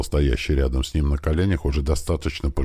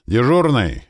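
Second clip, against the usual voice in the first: high, louder call across the yard; shouted summons; 9 semitones higher, 5 dB louder, slower than usual. A narrator's reading. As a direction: holler for the man on duty. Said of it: "крикнул он одному из бойцов". (окликнуть громко)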